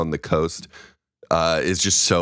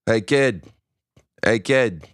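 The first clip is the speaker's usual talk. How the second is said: lightly voiced Boston accent; a hint of a Boston street guy; 4 semitones higher, slower than usual. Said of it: "do the Boston accent".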